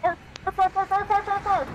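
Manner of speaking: high, loud yapping barks; yappy little dog. Pretend. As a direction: bark like a small excited dog. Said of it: "Bark for me if you're a dog".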